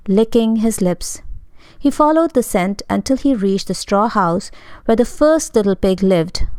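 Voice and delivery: ordinary delivery, nothing marked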